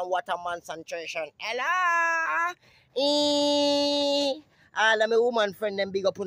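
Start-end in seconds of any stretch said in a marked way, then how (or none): 1.4-4.4 high call. Acted. Calling out.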